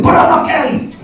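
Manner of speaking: high, loud blurted denial; shouted disclaimer. Retold or imitated. Brighton local abroad to a stranger, loudly protesting. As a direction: shout it out as an over-insistent mock protest.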